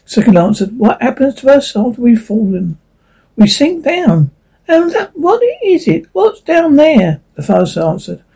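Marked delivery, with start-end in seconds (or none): 0.8-7.2 higher, anxious leaf voice. Acted. Leaf asks about falling.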